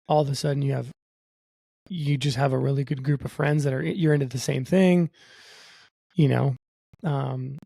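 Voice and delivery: usual speaking voice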